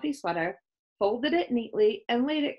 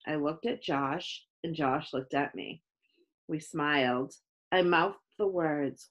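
usual speaking voice

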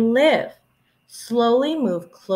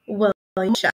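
plain reading voice

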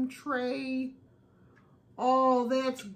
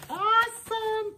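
squeaky high repeat of Awesome; cartoonish high-pitched delight; chipmunk voice; 12 semitones higher, 4 dB louder than usual. Shown as a demonstration. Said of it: "and your chipmunk voice".

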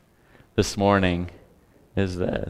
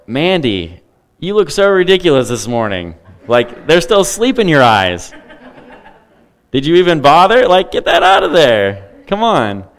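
higher, louder, scolding a ridiculous neighbour; wife playfully mocked for a log in her eye, loud scolding; 8 semitones higher, 10 dB louder than usual. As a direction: chide her like a fussy busybody over the sleep in her eyes. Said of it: "that's how ridiculous it is".

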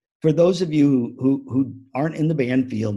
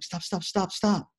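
quick repeated stops, pitched up; urgent interrupting; 6 semitones higher, 4 dB quieter than usual. Retold or imitated. Speaker halting a panicking band dad, urgent. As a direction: cut off the panicker with rapid stops.

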